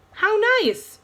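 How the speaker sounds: high, falsely sweet "How nice!"; fake delight. A narrator's reading. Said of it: "I didn't like the sound of his voice".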